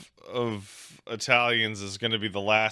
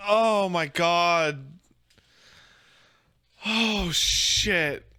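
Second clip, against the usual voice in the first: recovering from laughter; 8 semitones higher than usual. Real, not acted.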